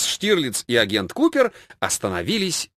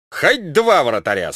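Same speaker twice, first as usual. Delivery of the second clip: usual speaking voice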